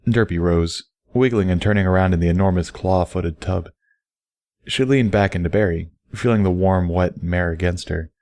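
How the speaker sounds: ordinary delivery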